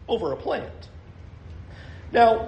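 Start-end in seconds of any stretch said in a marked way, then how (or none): 0.1-0.9 "over a plant" much softer, ending on a questioning rise; quiet echo. Shown as a demonstration. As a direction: drop the voice and let "plant" lift like a question. Preacher repeats phrase, dropping voice.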